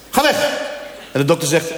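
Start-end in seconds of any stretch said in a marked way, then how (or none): 0.1-0.5 ga weg shouted. Pretend. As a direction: shoo him away for good. Shooing imagined fat man.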